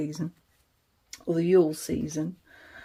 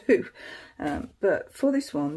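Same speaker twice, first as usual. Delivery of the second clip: normal talking voice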